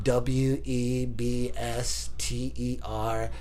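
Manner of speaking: slow, low, letter-by-letter spelling; soft, deliberate reenacted spelling; concentrated recitation. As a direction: spell it out slowly under your breath. Spelling names to hold off climax.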